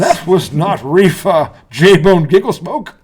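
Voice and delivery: slow mock British accent; a slow, hammed-up British accent; a playful fake British accent. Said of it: "as we're talking in this British accent".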